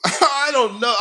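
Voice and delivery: sarcastic repeat of Albert's excuse; mocking echo. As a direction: parrot his "I don't know" back with scorn.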